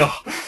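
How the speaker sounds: high disgusted ugh; a real grossed-out groan. Real, not acted. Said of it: "that turned me off".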